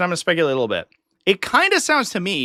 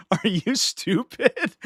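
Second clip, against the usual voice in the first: mocking disbelief; 4 semitones higher, much slower than usual. Real, not acted. Mocking absurd streaming demand.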